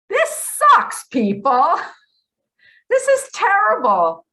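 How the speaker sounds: loud, high, fed-up wail of complaint; exasperated outcry. Real, not acted. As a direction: throw up your hands and cry it out loud. Lamenting the sickness to listeners.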